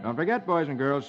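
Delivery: plain reading voice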